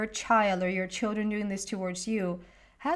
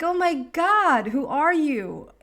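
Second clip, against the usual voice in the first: amazed; 5 semitones higher, 5 dB louder, slower than usual. Retold or imitated. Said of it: "I was like".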